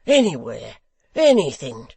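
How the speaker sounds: loud, wild shout; crying out. Acted. Hugh to Gashford, loud desperate shout. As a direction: fling it out recklessly. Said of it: "cried Hugh".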